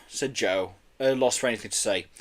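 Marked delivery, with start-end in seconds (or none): none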